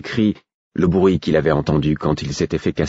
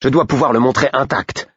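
higher, sharp irritated king; 7 semitones higher than usual. A narrator's reading. The king irritably orders wine from a servant. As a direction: snap the order like an annoyed king. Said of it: "d'un ton irrité".

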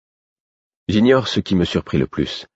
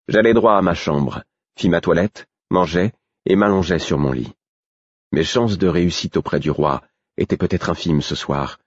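ordinary delivery

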